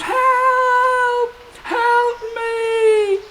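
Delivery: very high, far-off cry for help; a small trapped voice calling out high; tiny voice crying help. Acted. Fairy princess stuck in mud, crying high for help. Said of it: "a voice shouted out".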